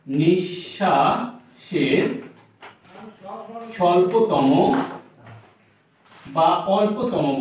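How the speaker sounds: "নিঃশ্বাসে" drawn out in separate syllables; slow, broken-up "নিঃশ্বাসে" for note-takers; slow dictation. Shown as a demonstration. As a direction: dictate "নিঃশ্বাসে" very slowly, with a break inside. Teacher dictating word by word.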